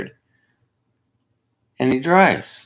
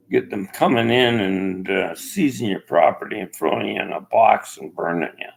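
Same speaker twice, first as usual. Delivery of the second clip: ordinary delivery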